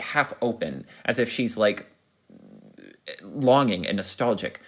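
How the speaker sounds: normal talking voice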